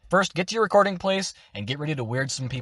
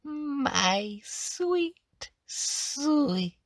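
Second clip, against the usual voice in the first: finished hag voice; 4 semitones higher, 4 dB quieter, much slower than usual. Shown as a demonstration. Hag sweet-talking a player to get a finger, sing-song crone. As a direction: croon sweetly, savouring your prey. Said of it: "it's gonna sound a little bit like this".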